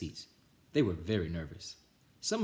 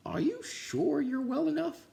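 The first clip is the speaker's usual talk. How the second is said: high, slow principal; high slow concerned principal. Acted. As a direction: ask with fussy concern. Principal asks.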